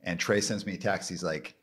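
normal talking voice

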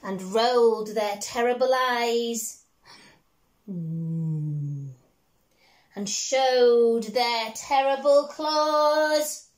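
put-on slow, lilting read-aloud to a small child; storybook reading. Parent reading a picture book to a child, gently lilting.

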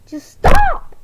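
high, loud furious snap of stop; angry shout. Acted. Annoyed doll yelling at the baby-talker. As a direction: yell it to shut them up.

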